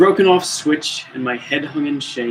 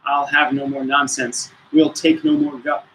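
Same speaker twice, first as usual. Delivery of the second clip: usual speaking voice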